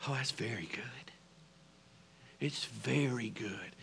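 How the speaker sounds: tender hushed whisper, slowing down; a soft unvoiced whisper of approval; whispered God's voice. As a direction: breathe his words like a secret. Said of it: "and he says".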